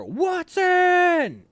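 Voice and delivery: high, loud barked name from far off; distant, angry sergeant's yell; angry drill sergeant shout. Retold or imitated. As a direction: yell the name across the formation. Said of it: "they don't sound happy".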